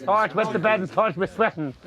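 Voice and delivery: light Irish accent imitating his mum; mum's Irish lilt, lightly done. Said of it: "In an Irish accent".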